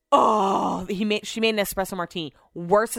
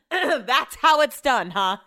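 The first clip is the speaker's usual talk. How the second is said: smug gloat; 5 semitones higher, 4 dB louder, slower than usual. Retold or imitated. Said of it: "leans over ... to the lady and says".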